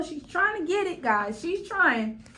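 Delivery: ordinary delivery, nothing marked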